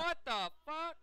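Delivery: loud, high-pitched cry of disbelief; exasperated outburst. Real, not acted. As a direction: burst out in real outrage at the race. Outburst while watching race.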